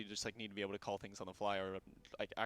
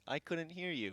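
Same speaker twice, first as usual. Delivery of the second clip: stiff robotic assistant voice, higher; 7 semitones higher, 4 dB louder, slower than usual. Retold or imitated. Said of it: "speaks in this very robotic voice".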